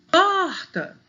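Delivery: PORTA with POR high, loud and held; calling the word. Shown as a demonstration. Teacher showing penultimate stress, calling POORta. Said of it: "o por é mais forte".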